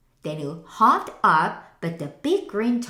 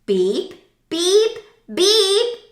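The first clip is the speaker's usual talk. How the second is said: beeps building higher and louder; closing beeps; 11 semitones higher, 6 dB louder, slower than usual. A narrator's reading. The narrator ending the story with beeps. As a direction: finish with three cheery beeps rising.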